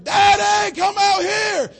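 put-on high, loud children's shout calling Daddy; kids screaming. Children shouting to wake their father up loudly. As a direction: shriek it like scared kids at dawn. Kids waking dad in alarm.

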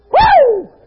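high, excited "Woo!"; preacher's whoop. Real, not acted. Preacher to congregation, exulting: high whoop. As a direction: let out a high holy whoop.

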